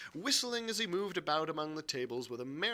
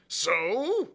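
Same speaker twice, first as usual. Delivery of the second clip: high, loud exclamation; crying out; 8 semitones higher, 8 dB louder than usual. A narrator's reading. Silver hearing of the buccaneers, crying out. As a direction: burst out in surprise. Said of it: "cried Silver".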